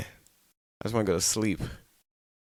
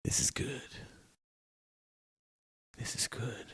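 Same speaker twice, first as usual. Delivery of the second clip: whispered; 13 dB quieter than usual. Retold or imitated. Child comforted by the meal.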